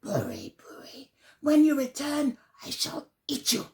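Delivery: whispered name, then a light snarl; snarling fox. Acted. Fox threatening Buri, whisper then light snarl. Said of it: "snarled the fox".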